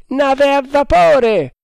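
very high, slow, loud cry; shouted hail. A narrator's reading. Lookout announcing a steamship, shouting. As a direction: draw it out from the masthead. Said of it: "gridò poi".

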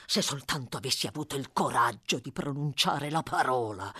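low-pitched, held-in rage; seething fury. A narrator's reading. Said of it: "in preda a una furia terribile". (furia trattenuta, a denti stretti)

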